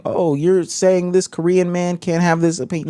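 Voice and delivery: lightly voiced indignant objector; mock critic. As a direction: imitate an imagined commenter, mocking. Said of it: "I can already see people saying".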